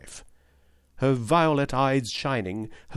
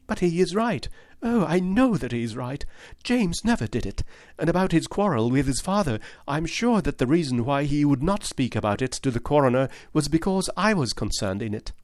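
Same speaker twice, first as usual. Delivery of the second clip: put-on higher, pleading young lady's voice; earnest young woman; 6 semitones higher than usual. Miss Turner insisting to Holmes that James is innocent, higher earnest voice. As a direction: plead for James with eager conviction.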